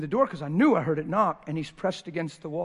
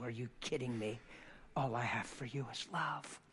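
tender reassurance; 2 semitones lower, 7 dB quieter than usual. Retold or imitated. Father softly reassures his son he is not mad. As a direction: comfort him quietly, close. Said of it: "I said".

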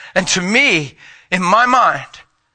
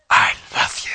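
whispered like a sacred vow; tender whisper. Shown as a demonstration. Preacher to congregation, the true meaning, whispered tenderly.

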